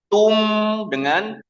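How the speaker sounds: Tum said slowly with lips pushed forward; rounded tum demo. Shown as a demonstration. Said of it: "Beda nggak suaranya?". (Tum dengan bibir monyong, pelan)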